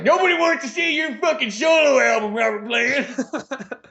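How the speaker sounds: high, loud outburst drawn out at the end; exasperated mock yell. Fan telling singer off over solo album, exasperated yell.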